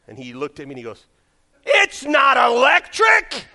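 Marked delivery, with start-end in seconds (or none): 1.6-3.5 put-on high, drawn-out childlike shout; imitated kid's yell. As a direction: blurt it out at full volume like a kid. Kid's discovery, acted out.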